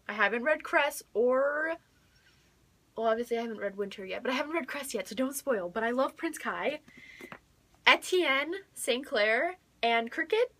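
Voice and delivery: normal talking voice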